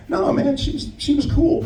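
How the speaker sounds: high, soft pleading; high defensive reply. Retold or imitated. Comic answers friends defending the Queen, high voice. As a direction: squeak out the defence. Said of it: "And I was like".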